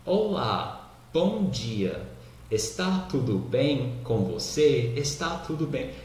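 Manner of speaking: stiff, over-enunciated textbook greeting; slow, fully pronounced words, like a language drill; unnaturally correct. Shown as a demonstration. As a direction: greet with every syllable spelled out. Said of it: "parece ser uma coisa menos natural. Se eu falasse assim".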